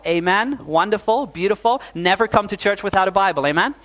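usual speaking voice

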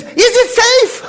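high, slow, loud fearful squeal; frightened wife. Retold or imitated. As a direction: ask as if danger is next door. Wife's fearful question, high.

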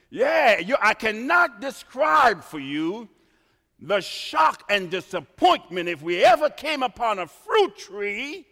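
loud, high preaching cry; fervent loud pulpit delivery; preaching at full force. Acted. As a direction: preach it out to the congregation. Preacher builds to shouting.

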